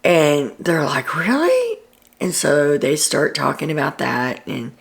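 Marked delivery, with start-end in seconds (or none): 1.2-1.8 surprised really. Retold or imitated. Houseguests react, surprised by news.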